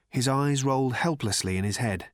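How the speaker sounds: ordinary delivery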